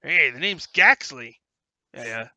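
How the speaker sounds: lightly voiced gnome with a cigar; cigar-chomping gnome. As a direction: chew the words like there's a cigar in your mouth. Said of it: "gnome comes over chomping on his cigar".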